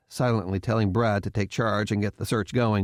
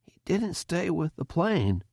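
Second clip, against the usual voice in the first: curious; 7 semitones higher than usual. A narrator's reading. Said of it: "Markham said curiously".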